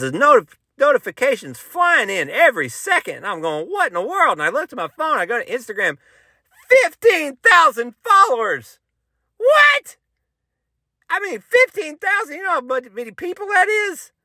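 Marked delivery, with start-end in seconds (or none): 3.7-4.3 baffled. Retold or imitated. Retelling his reaction to notifications. 6.7-14.1 very high, hammed-up shock; high-pitched comic freak-out; astonished disbelief. Reenacting shock at follower count.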